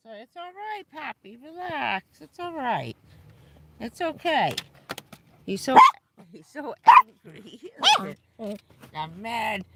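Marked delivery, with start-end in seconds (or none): 0.1-4.5 soothing. Real, not acted. Soothing the bird.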